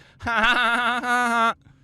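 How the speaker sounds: loud, high cackle of fake glee; mock gleeful laugh. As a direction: cackle like a cartoon bully, loudly. Mocking those who humiliate kids.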